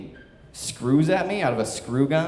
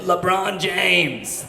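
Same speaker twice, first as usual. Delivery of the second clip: drawn-out, higher-pitched holler of a name; slow, raised mock victory cry; triumphant call-out; 5 semitones higher, 4 dB louder, much slower than usual. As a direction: imitate calling a showboat basketball shot, stretching each word. Acting out boss throwing chalk.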